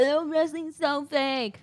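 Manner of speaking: high, drawn-out mock dismissal; mocking sceptics. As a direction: parody a scoffer, stretching the words. Mocks the sceptics she then rebuts.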